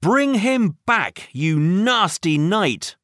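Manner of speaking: high-pitched indignant call, not a full shout; crying out. A narrator's reading. Minnie calls after Rex's captor, crying out high. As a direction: call out high, easing down towards the end. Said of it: "cried Minnie".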